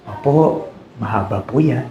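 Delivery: small quiet frightened reply; meek child answering softly; scared child. Retold or imitated. Preacher voicing a child scared of the rod, quiet meek reply. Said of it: "Oh, anak".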